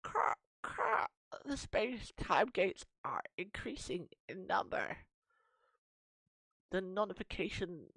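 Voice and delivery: slow, high croaking villain voice; crow villain. Acted. Crow villain gloats to the heroes, slow and croaking. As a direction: gloat slowly like a cackling crow.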